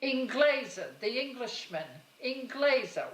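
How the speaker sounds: put-on mother's Italian accent. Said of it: "[name] was her favorite".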